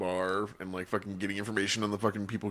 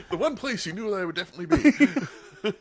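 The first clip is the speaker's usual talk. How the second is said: high-pitched, laughing through the words; barely holding back laughter; 11 semitones higher than usual. Real, not acted. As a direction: crack up while finishing the joke. Cracking up over own joke.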